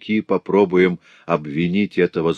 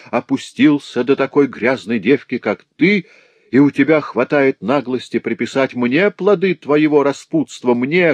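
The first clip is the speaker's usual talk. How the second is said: furious tirade; 7 semitones higher, 3 dB louder than usual. Acted. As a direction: spit the insults at her. Master abuses pregnant servant.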